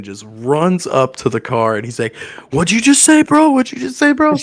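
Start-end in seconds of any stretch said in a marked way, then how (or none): 2.5-4.4 mock tough challenge. Justin confronting the car guys.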